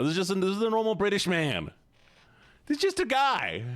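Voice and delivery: high-pitched, exasperated insistence; indignant protest. Real, not acted. Indignantly defending the man in photos.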